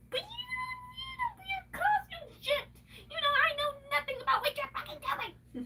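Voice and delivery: hammed-up weepy wail; mock sobbing wail. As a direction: wail it like a pretend cry. Mock crying to herself.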